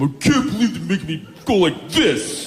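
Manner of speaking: a cranky, whiny God complaining; high squeaky voice griping at the cash machine; God at the ATM. Retold or imitated. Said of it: "probably just God standing at the A T M".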